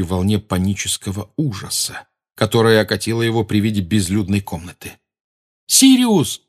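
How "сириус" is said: loud, high desperate shout. Acted. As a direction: call his name out loud. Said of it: "крикнул он".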